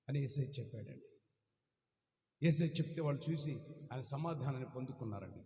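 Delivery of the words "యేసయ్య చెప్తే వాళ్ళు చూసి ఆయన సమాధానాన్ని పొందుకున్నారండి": ordinary talk, nothing marked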